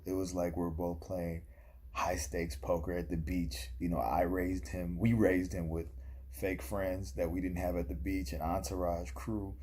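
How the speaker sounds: usual speaking voice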